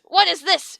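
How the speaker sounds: high, loud furious yell; the teacher yells at the answer. A narrator's reading. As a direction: yell it in fury. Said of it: "she yelled in fury".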